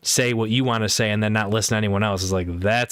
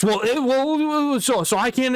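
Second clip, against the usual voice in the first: much higher, slow stammering imitation; mock flustered friend; 13 semitones higher, slower than usual. Imitating flustered friend stuck for words, high and slow. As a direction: stumble over it, up high and drawn out.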